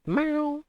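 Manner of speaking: high-pitched held meow; cat meow. Pretend. Streamer to chat, high playful meow. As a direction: mimic a cat in a high, softish squeak.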